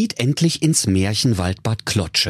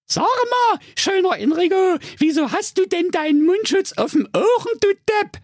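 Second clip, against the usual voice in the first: put-on much higher cheeky young girl voice; Rapunzel. Rapunzel mocking the witch, high-pitched girl voice. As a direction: play Rapunzel high and sassy.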